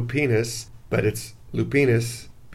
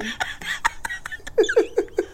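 breathless giggle; 4 dB quieter than usual. Real, not acted. Laughing at own dog voice.

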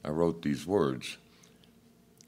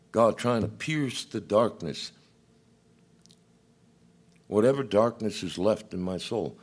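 high, louder impassioned preaching; 7 semitones higher, 4 dB louder than usual. Acted. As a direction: preach it with rising fervour. Preacher at full force.

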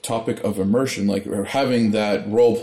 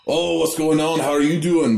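higher, lightly put-on character voice; lightly acted, higher-pitched greeting; talking in character; 6 semitones higher than usual. Game master greets players in character, lightly put-on higher voice. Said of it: "I try to talk in character".

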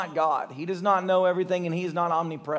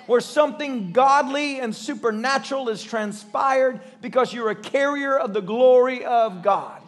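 higher, loud preaching climax; preaching at full force; 5 semitones higher, 3 dB louder than usual. Acted. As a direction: build the sermon to a peak.

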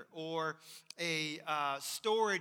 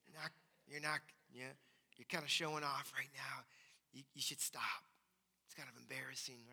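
hushed, lower confiding voice; God's aside; 5 semitones lower, 9 dB quieter than usual. Retold or imitated. God quietly telling the show-off to stop. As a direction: murmur it like a private disapproval. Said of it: "God might be saying".

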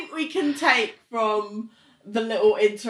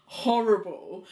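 ordinary talk, nothing marked

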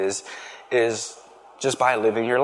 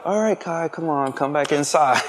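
put-on higher, worried call to a toddler; anxious calling; 6 semitones higher than usual. Dad scared of heights calls his daughter back inside, anxious. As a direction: coax her back from the edge, nervous.

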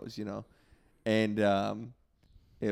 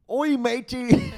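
high, slow put-on Welsh accent with a laugh; mock Welsh old man greeting, half laughing; mock Welsh grandfather; 13 semitones higher, 7 dB louder, slower than usual. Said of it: "it's like this".

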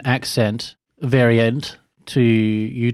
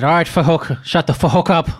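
put-on raised-pitch Irish gangster accent, punching the swearing; Colin Farrell Irish accent; 5 semitones higher than usual. Podcaster quoting The Gentlemen for laughs, mock Irish accent. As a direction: mimic an Irish screen tough in a higher voice. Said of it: "I think of, what is it, The Gentleman? He's like".

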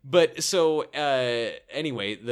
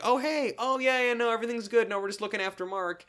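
high-pitched fake-casual phone voice; teen on phone; 9 semitones higher than usual. Teen reassuring parents on the phone, high casual cover-up voice. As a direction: play it innocent on the phone, pitched way up. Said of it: "picks up the phone and he's like".

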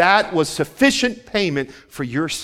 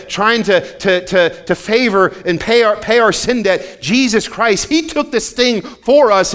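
louder and higher, driving hard; preaching at full force; 6 semitones higher, 6 dB louder than usual. Acted. Preacher proclaiming to the church that Christ took the sting, full force. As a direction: push the message home to the room.

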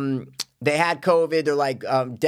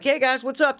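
friendly greeting; 13 semitones higher than usual. Retold or imitated. Greeting kids from the yard.

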